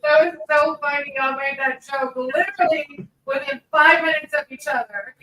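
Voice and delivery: loud delight straight after a laugh; real amusement, laugh at the end; amused, laughing reaction. Real, not acted. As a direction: say it loudly, tickled by the joke.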